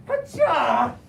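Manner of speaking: loud martial-arts shout; imitated karate roar; Rez's karate yell. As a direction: burst out with a battle cry. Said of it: "As Rez was going".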